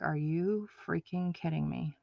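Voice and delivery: low, soft, exasperated mutter; quietly incredulous aside; muttered disbelief. Retold or imitated. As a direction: grumble it under your breath in disbelief. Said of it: "And I'm like".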